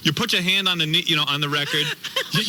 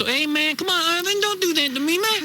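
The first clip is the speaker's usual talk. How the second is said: much higher put-on street voice; mock homeboy pleading, pitched way up; hammed-up urban slang plea. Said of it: "That's how I talked for three years".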